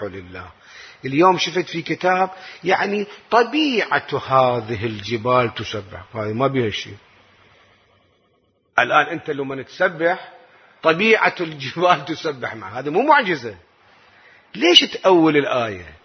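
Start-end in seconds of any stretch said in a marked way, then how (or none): none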